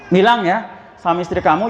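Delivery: ordinary talk, nothing marked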